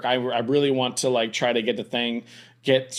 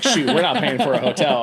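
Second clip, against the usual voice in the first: louder, higher, amused comeback; laughing mock protest; 4 semitones higher, 6 dB louder than usual. Husband jokingly refusing to pay for wife's hotel, laughing.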